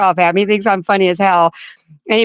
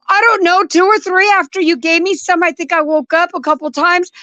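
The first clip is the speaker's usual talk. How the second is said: dazed fear; 7 semitones higher than usual. Retold or imitated. Drugged wife answering husband.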